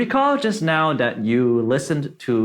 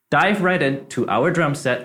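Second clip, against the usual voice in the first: usual speaking voice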